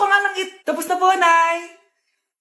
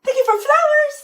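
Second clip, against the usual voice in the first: TikTok NPC live; 9 semitones higher than usual. Pretend. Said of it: "yung A I na trend sa TikTok".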